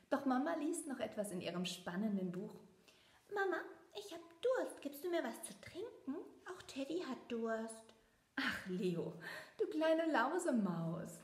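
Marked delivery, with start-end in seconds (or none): 3.3-7.9 put-on high, little-boy plea; a small mouse asking sweetly for a drink; child mouse voice. A narrator's reading. Leo calls to his mother from bed. 8.4-11.2 fond, slow, amused; tenderly scolding her little rascal; smiling mother. A narrator's reading. Mama answers her thirsty son with a smile. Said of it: "sagt die Mama lächelnd".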